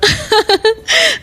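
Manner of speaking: a teasing laugh at his expense; mocking laugh. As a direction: laugh at him, amused and mocking. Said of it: "तुम मुझ पर हंस रही हो क्या". (चिढ़ाती हुई हंसी)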